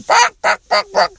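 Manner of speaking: very high imitated chicken squawks; jeering cooks' chicken noises; mock chicken clucking. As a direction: squawk "bawk bawk" to taunt someone. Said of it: "the Japanese cooks are going".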